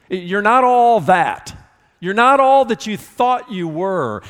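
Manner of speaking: Jesus humbling Peter, punched; emphatic rebuke. Acted. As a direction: hammer home that he falls short. Preacher voicing Jesus' rebuke.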